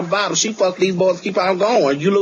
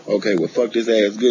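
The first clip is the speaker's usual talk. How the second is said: gentle giant voice; 8 semitones lower than usual. Retold or imitated. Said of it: "And he be like".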